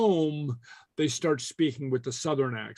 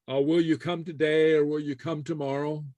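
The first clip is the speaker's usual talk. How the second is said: normal talking voice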